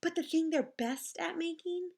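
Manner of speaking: ordinary delivery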